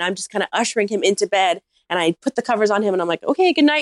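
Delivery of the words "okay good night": high, lightly acted dismissal; a touch of high, hurried politeness; brisk good night. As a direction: chirp it to end the evening. Putting drunk guest to bed, acted.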